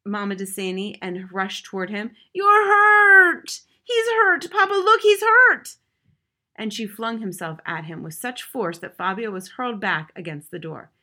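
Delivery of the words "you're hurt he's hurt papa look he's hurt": very high, loud panicked cry; frantic shriek. Acted. Mother shrieks that her son is hurt, frantic cry. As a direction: shriek over a tiny bandage.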